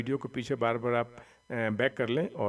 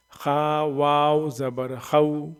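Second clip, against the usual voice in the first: slow spelling recitation; 4 dB louder, much slower than usual. Shown as a demonstration. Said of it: "यहाँ पर क्या था ये?".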